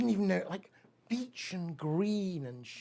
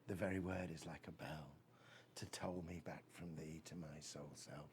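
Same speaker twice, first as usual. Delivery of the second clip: quiet recital; 8 semitones lower, 14 dB quieter than usual. Acted. Recites Keats ode.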